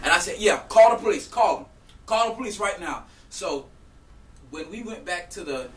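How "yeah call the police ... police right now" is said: higher, loud dare; retold defiant shout. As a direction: re-enact daring someone to go ahead. Acts out daring her back.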